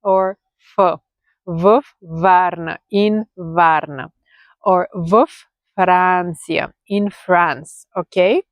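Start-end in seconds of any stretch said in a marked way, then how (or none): 1.4-7.8 "V Varna" slowed right down, each sound clear; teacher slowing down to model the form; slow, careful demo. Shown as a demonstration. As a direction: spell out "V Varna" slowly for learners. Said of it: "the next word after that should start with v".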